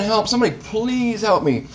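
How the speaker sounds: high-pitched pleading cry; desperate cry for help. Acted. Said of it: "he's crying again".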